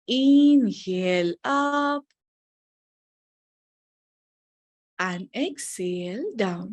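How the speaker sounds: very slow breathing cue. Shown as a demonstration. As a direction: pace the words to a full breath.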